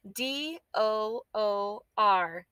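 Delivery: slow, letter by letter; spelled out letter by letter. Shown as a demonstration. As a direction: spell each letter out slowly. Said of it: "spelling the word aloud".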